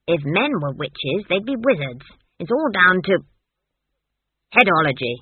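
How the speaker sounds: put-on emphatic old witch scorning wizardry; opinionated Granny. Granny explains headology to Esk, scornful. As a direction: lecture forcefully, building to exasperation.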